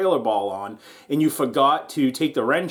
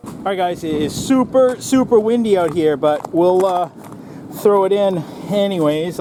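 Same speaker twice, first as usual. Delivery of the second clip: louder and higher, calling over the wind; raised over wind; 4 semitones higher, 5 dB louder than usual. Real, not acted. Filming outside in the wind, raised voice over it. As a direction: push the voice over gusts outside.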